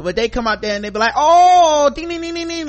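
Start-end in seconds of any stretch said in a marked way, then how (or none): 1.2-2.7 high, loud hammed-up teppanyaki chef; mock showman chef. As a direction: play up the show chef banging the spatula. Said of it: "talking in broken English".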